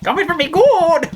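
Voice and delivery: high mock leprechaun cry. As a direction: squeak it like a greedy leprechaun. Mocking leprechaun line.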